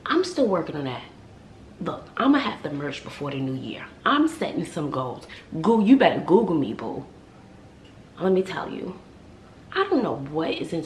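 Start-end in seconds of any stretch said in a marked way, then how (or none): none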